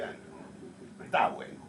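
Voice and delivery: breathy half-whispered retold thought, barely voiced; whispered aside. A director recalling his discovery, whispering to himself. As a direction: whisper it to yourself like a troubling realization.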